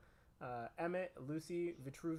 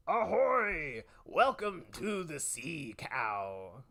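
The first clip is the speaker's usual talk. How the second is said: pirate voice; 5 dB louder, much slower than usual. Acted. Pirate character greeting.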